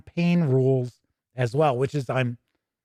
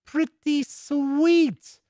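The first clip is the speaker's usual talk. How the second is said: much higher, very slow and drawn out; pleased; 11 semitones higher, much slower than usual. Real, not acted. Host delighted by the sale price, high and drawn out. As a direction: savour it, stretched high and slow.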